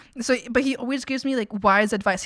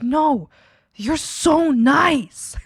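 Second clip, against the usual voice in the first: insistent; 4 semitones higher, 5 dB louder, much slower than usual. Retold or imitated. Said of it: "And she's like".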